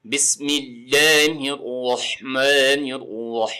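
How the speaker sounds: very slow Quranic recitation with long held vowels; tajweed recitation. Shown as a demonstration. Teacher reciting the opening line for learners, tajweed recitation. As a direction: recite it measured, stretching the final syllable far out. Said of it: "تجوید کے مطابق پڑھنا".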